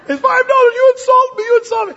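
very high, loud squawk of offence; hammed-up insulted merchant; outraged shopkeeper. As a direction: explode in mock outrage, high-pitched. Acts offended shopkeeper after the slap.

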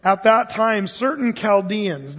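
solemn scripture reading. Real, not acted. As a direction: read it out like holy writ. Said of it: "It says".

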